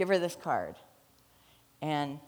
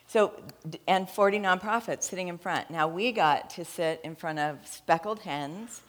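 ordinary talk

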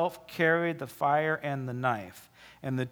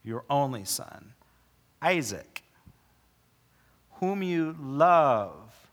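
slow, deliberate reading with pauses, whom you love drawn out; paced reading; slower than usual. Shown as a demonstration. Preacher shows the congregation the pace, slow and deliberate. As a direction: give each phrase its own beat, lingering on love. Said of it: "There's a pace to this".

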